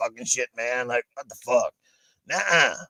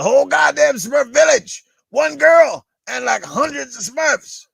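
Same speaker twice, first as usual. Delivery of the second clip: high, loud, slowed punchline; high, loud drawn-out punchline; 11 semitones higher, 8 dB louder than usual. Acted. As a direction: land the joke big and slow.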